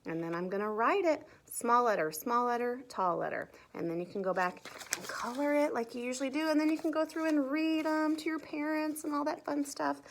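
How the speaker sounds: usual speaking voice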